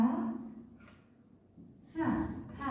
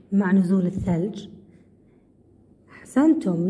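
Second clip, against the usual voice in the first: loud classroom teacher; 4 semitones lower, 11 dB louder than usual. Acted. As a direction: address the whole class, loud and clear. Teacher reads quiz to class. (معلمة تخاطب الصف بصوت عالٍ)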